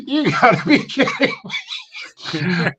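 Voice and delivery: slow, high, laughing through it; can't believe it, half laughing; laughing disbelief. Retold or imitated. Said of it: "I was like".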